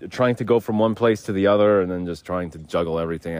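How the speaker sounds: normal talking voice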